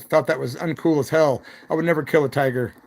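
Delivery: ordinary talk, nothing marked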